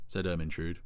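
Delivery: ordinary delivery, nothing marked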